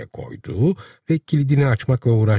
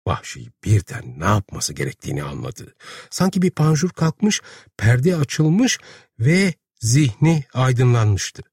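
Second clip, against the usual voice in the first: usual speaking voice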